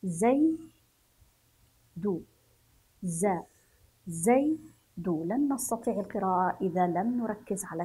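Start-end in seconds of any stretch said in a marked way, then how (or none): 0.0-5.2 زي and دو as separate syllables, pauses between; teacher's syllable-by-syllable زي، دو; spelling out. Shown as a demonstration. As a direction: sound out زي... دو piece by piece. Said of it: "نتهجى الكلمات ونقرأها".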